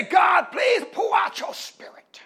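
high pleading cry fading to a whisper; mock pleading prayer. Preacher imitating people pleading with God, desperate. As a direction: beg God in a mock plea.

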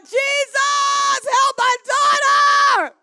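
high, loud, slow plea; crying out. Acted. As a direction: stretch out the cry for the daughter. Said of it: "She's crying out".

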